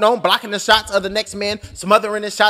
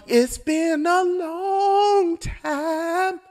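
mock wistful; 6 semitones higher, 5 dB quieter, much slower than usual. Wistful dramatic aside.

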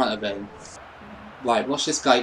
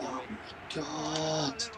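very soft, drawn-out lament; quiet, stretched-out dismay; despairing groan; 17 dB quieter, much slower than usual. Real, not acted. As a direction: let it trail out slowly, defeated. Despairing after losing the match.

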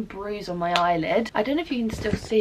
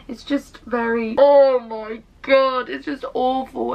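slow, loud, higher-pitched groan of despair; exasperated wail; 4 semitones higher, 7 dB louder than usual. Real, not acted. Vlogger venting about her stagnant life, exasperated wail. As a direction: draw it out in exasperation.

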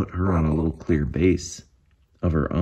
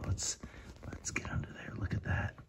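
soft conspiratorial whisper, much softer; 16 dB quieter than usual. Real, not acted. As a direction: lean in and whisper it. Peeking under the clear base.

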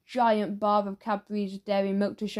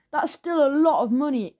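loud, higher-pitched exclamation; exclaiming; 4 semitones higher, 6 dB louder than usual. A narrator's reading. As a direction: burst out with it, loud and high. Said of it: "exclaimed Bob".

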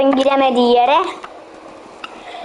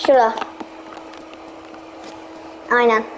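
normal talking voice

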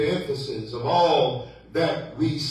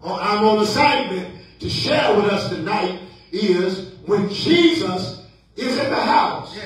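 emphatic preaching; 5 semitones higher, 3 dB louder than usual. Acted. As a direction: proclaim the sermon theme with stretched key words. Preaches his theme.

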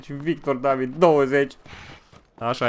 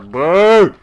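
short, high, loud yell; loud shout; 6 semitones higher, 11 dB louder than usual. Real, not acted. Streamer yelling at his donors, loud disbelieving shout. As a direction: bark it at the chat.

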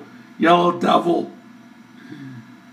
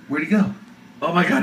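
normal talking voice

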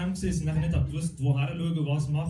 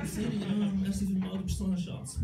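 sweet-talking Siri; 4 semitones higher, 8 dB quieter than usual. Acted. Sweet-talking the phone assistant.

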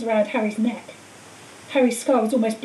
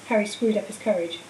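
usual speaking voice

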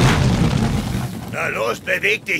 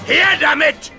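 high, loud demand; eager battle shout; 8 semitones higher, 4 dB louder than usual. Acted. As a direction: shout for the danger to be handed over. Hero demands the danger.

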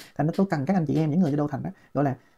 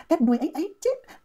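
cắt đuôi read much higher; 10 semitones higher than usual. Shown as a demonstration. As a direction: lift "cắt đuôi ấy ấy chết" high. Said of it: "các bạn nghe tôi đọc". (đọc "cắt đuôi ấy ấy chết" giọng cao vút)

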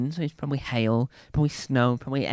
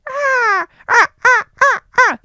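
very high loud crow caws; loud high squawking caw; crow noise; 10 dB louder than usual. Shown as a demonstration. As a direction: demonstrate a crow's caw. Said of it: "a crow noise is".

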